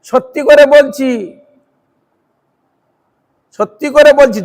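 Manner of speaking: forceful preacher's assertion; emphatic declaration. Shown as a demonstration. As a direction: proclaim it with full conviction. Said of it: "জোর দিয়ে বলছে".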